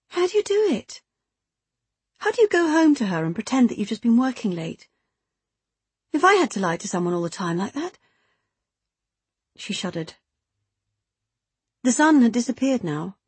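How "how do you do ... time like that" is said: lightly voiced woman, opening very high; incredulous secret lover. Woman asking lover how he lies at home, incredulous. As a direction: start high in disbelief, then ease down.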